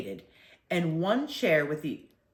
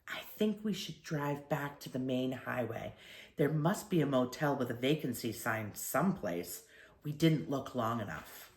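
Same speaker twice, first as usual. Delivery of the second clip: hushed, soft voice; whispering; 4 semitones lower, 7 dB quieter than usual. A narrator's reading. Mother whispers worry to the father, hushed. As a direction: keep it down so the bellboy won't hear. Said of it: "and whispered".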